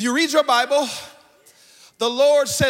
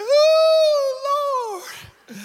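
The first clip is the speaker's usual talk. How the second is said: hammed-up wail, much higher, half laughing; mock ugly cry; 4 dB louder than usual. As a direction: overdo a sobbing wail for laughs. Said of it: "You know that ugly cry".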